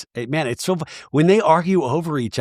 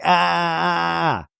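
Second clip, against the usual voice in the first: high, held "aaah"; mock wail on a single held note; drawn-out mock yell; 3 semitones higher, slower than usual. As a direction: let out one long pretend holler. Mimics Kay's rebuttal of ranting.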